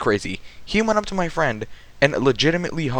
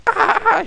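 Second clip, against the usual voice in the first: badly faked high-pitched scream; put-on scream, high but not full force; bad mock scream; 4 dB louder than usual. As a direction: imitate a screaming girl, much higher than usual. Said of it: "bad impersonations of a person screaming".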